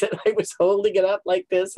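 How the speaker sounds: ordinary talk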